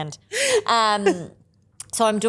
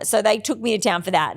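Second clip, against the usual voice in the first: ordinary talk, nothing marked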